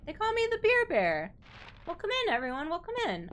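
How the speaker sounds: high, bubbly welcoming voice; squeaky-sweet anime girl host; cute anime streamer. Acted. Welcoming raiders in persona.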